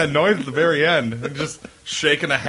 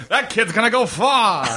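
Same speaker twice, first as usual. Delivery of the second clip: high, hammed-up rave; mock promoter hype; 8 semitones higher than usual. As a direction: crow like an old-time talent scout. Joins the mock talent-scout praise.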